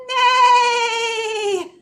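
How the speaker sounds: loud high whinny; horse neigh. Shown as a demonstration. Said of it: "What does he say".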